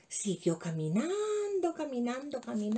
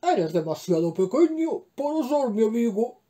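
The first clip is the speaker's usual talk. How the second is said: gruff, louder hippo grumble; a grumbling hippo brushing off the mouse; growled refusal; 3 semitones lower, 7 dB louder than usual. A narrator's reading. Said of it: "le contestó con un gruñido".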